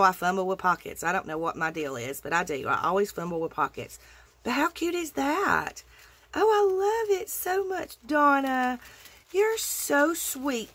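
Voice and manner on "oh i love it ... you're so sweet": high, loud, adoring cry; delighted gush. Real, not acted. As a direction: gush with love over the gift. Gushing thanks to the sender.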